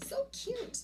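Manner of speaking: high squeal of delight. Real, not acted. Vlogger squealing at the cute diffuser, high delight. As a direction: gush over it like a toy.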